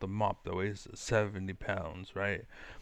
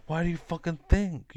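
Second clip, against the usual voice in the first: louder, higher angry demand; exasperated outburst; 7 semitones higher, 6 dB louder than usual. Real, not acted. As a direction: snap it out, fed up. Angry rant at imagined questioner.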